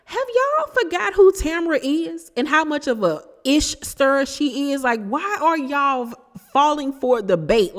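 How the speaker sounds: high-pitched exasperated disbelief; exasperated high rant. Real, not acted.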